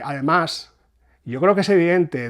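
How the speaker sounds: normal talking voice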